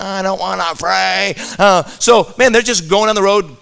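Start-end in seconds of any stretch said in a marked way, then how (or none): 0.0-1.3 mock childish whine, softer; whining kid. Brother refusing to pray for his sick sibling, whining. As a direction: drag it out like a sulky kid. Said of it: "Killian means whining".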